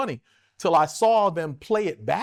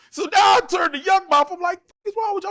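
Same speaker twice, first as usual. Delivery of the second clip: heated yelling; 12 semitones higher, 5 dB louder than usual. Retold or imitated. Heated fight retold.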